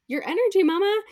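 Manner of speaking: "your energy, mama" jumped high and loud; emphatic climax. Real, not acted. Emphatic climax addressed to friend.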